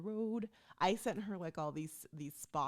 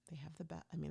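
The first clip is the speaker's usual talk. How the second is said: whisper; 7 dB quieter, faster than usual. Real, not acted. Whispered self-correction.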